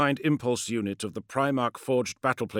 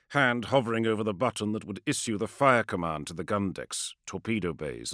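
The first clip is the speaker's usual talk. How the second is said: ordinary delivery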